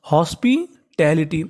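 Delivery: Hospitality slowly, in two separate parts; teacher's slow split of hospitality; sounded out. Shown as a demonstration. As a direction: pause after हॉस्पि, then finish टैलिटी.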